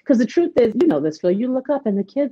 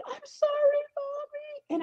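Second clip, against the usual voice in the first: put-on high, soft little boy's apology; imitating small child; 10 dB quieter, much slower than usual. Mother voicing her young son apologizing, high and soft. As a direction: voice a scared little boy saying sorry.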